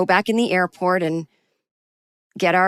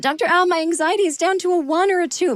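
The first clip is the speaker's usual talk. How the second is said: high-pitched imitation of a pleased classmate; chirpy classmate; 11 semitones higher than usual. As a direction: report progress in a mock high voice. Voicing calm classmates in story.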